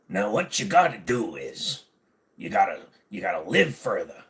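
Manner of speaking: a hint of a pirate; lightly voiced pirate growl. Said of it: "I'll do pirate".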